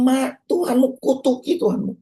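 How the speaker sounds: tinggi dan pelan, mengeluh tanpa marah; istri yang mengeluh. Retold or imitated. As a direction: suara istri Ayub yang meratap. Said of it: "dia komplain, dia nggak marah". (keluhan istri, tinggi dan lambat)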